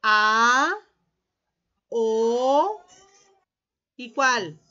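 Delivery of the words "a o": "A", "O" loud and long, each rising; open vowels sounded out. Shown as a demonstration. Teacher prompting pupils with the open vowels, loud and drawn out. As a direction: sustain "A" and "O" as separate open vowels. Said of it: "¿Cuáles son las vocales abiertas?".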